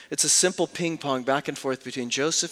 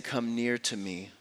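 soft tearful invitation; 5 semitones lower, 8 dB quieter than usual. Acted. Joseph in tears, inviting.

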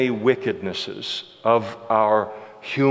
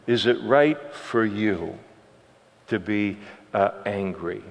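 softer, calm question from God; gentle reasoning; 5 semitones lower, 6 dB quieter than usual. Retold or imitated. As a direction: reason kindly with an angry prophet. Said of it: "the Lord tries to reason with him, and it's beautiful".

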